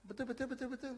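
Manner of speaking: high squeaky cartoon-like chant; imitating a child. Child answering every question alike, high mimicked parroting. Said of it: "Semua jawaban betul".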